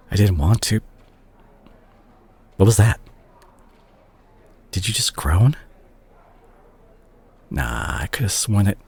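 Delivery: usual speaking voice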